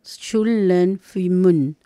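"Shum lei fui mun" spelled out slowly syllable by syllable; slow careful pronunciation. Shown as a demonstration. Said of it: "pueden ver por el sonido". ("Shum lei fui mun" muy lento, sílaba por sílaba)

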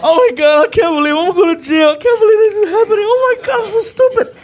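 high, loud panic on the verge of tears; panicking near tears. Retold or imitated. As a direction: freak out about going to jail. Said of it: "I'm on the verge of tears".

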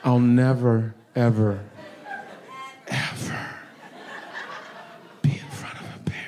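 from firm to a whisper; firm start, fading to a whisper; fading vow. Acted. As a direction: let the vow fade to nothing.

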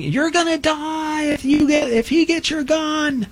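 high, drawn-out mock scream, held back in volume; imitated instructor yell. Academy instructor yelling in a recruit's ears, imitated screaming. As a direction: stretch the warning out high, like a drill instructor. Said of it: "screaming and yelling".

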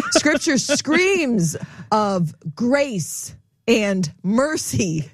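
high, slowly stretched SCREAMS; playful emphatic proclamation. Shown as a demonstration. Teacher showing where Scripture screams, playfully emphatic. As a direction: draw out "screams" high, without shouting.

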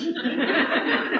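short high burst of laughter. Real, not acted. Preacher laughing at his own apple story. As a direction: break into a quick laugh.

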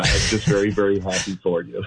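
happy, smiling, laughing through it. Real, not acted. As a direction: say it with a big smile. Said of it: "You can hear the smile in my voice".

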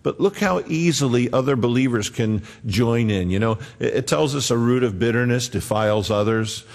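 usual speaking voice

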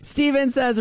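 ordinary delivery

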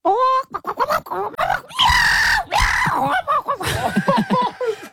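high shrieking squawks and screeches; chicken fighting a cat. Pretend. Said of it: "a chicken having a fight with a cat".

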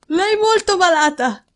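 put-on high, insistent servant girl; indignant maid's exclamation. A narrator's reading. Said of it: "esclamò la ragazza, lanciando uno sguardo indignato".

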